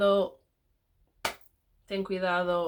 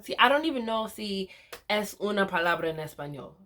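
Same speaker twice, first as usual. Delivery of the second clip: normal talking voice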